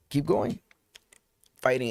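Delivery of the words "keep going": high, goading call; a voice up high urging keep going; taunting inner voice. Retold or imitated. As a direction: dare him to keep going. Inner voice daring him.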